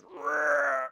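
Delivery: a loud rolled growl in a put-on voice; imitated growl. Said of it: "one guy goes".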